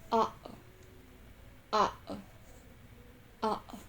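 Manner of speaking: "uh-oh" with a clean throat catch between syllables; glottal stop. Shown as a demonstration. Giving examples of the glottal sound, "uh-oh" split by a glottal stop. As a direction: close the glottis sharply inside "uh-oh". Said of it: "pronounced with the glottal".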